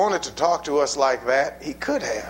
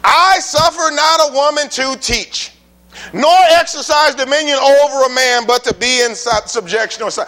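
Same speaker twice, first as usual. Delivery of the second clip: preaching loud; 5 semitones higher, 5 dB louder than usual. Real, not acted. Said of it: "When God wants to talk like that".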